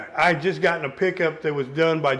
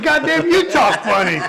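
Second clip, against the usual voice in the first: much higher and louder, laughing through it; laughing comeback; 10 semitones higher, 8 dB louder than usual. Retold or imitated. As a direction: fire it back while cracking up. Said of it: "we just start laughing".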